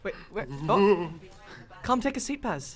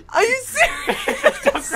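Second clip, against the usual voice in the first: slow words through laughter; laughing; 5 semitones higher, 5 dB quieter, much slower than usual. Real, not acted. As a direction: laugh while asking in disbelief. Laughing at game event.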